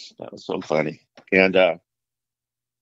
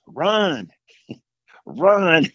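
high, louder mock-urgent call; retold urgent warning; 9 semitones higher, 5 dB louder than usual. Fleeing friend warning his hunting partner, acted urgent cry. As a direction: imitate a friend urging you to flee. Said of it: "the look on his face".

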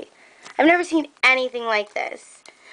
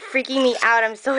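usual speaking voice

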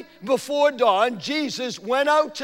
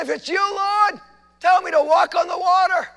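eager shouted plea; 7 semitones higher, 4 dB louder than usual. Retold or imitated. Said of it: "Peter said".

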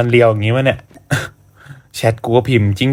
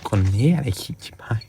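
whispered aside; 6 dB quieter than usual. Real, not acted. Muttered aside during match.

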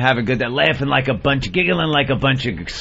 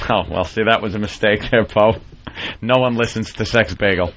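normal talking voice

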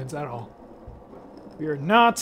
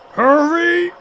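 high frantic shout; panicked yell; 11 semitones higher, 10 dB louder than usual. Real, not acted. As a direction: yell at the character to run. Urging character to escape.

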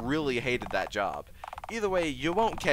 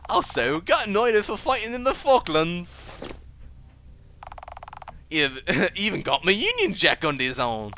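proud, louder hammed-up British accent; 3 semitones higher, 3 dB louder than usual. Passerby boasting of his country, hammed-up British accent. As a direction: boast like a jolly British patriot. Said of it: "Are you British?".

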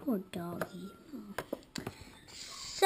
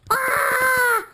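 short loud high yelp; jolted yelp of fright; startled scream; 10 semitones higher, 7 dB louder than usual. Real, not acted. Gamer spooked by a duck, loud startled yelp.

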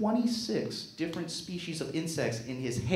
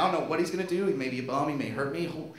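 ordinary talk, nothing marked

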